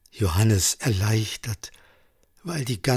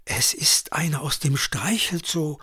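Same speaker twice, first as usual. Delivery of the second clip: airy, higher-pitched stage whisper; hushed, conspiratorial aside; 5 semitones higher than usual. A narrator's reading. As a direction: confide it like a secret. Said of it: "flüsterte fast verschwörerisch".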